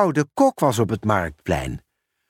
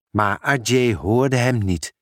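usual speaking voice

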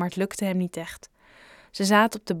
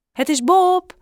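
put-on high, louder boyish voice; Bob at the door; 11 semitones higher, 8 dB louder than usual. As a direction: announce yourself through the door. Bob answering through door.

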